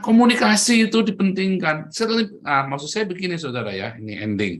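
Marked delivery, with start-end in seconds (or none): none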